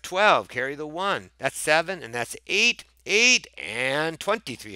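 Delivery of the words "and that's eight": ordinary talk, nothing marked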